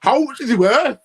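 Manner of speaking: high, loud mock question breaking into a laugh; mocking fan voice. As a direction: laugh at the fans you parody. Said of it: "I see it all the time".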